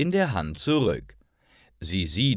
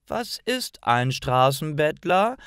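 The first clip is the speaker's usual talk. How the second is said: put-on a puzzled child's question, pitched higher; confused child asking grandma; 8 semitones higher than usual. A narrator's reading. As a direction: ask it baffled, like a little girl. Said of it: "fragt Lisa verwirrt".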